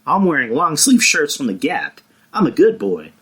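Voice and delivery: mock Ben as a harmless good boy; goofy nice-guy Ben. Mocking Ben's sudden nice-guy turn to his friend, lightly voiced. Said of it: "he just went from being murderous Kylo Ren to".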